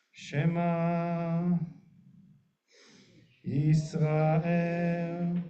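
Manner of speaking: each word drawn out slowly on the breath; one long breath. Shown as a demonstration. Rabbi demonstrating the breath-long Shema, slow and drawn out. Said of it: "every word becomes one breath".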